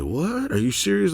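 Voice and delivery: high-pitched, softly voiced incredulity; incredulous. Friends deny finding him, he reacts.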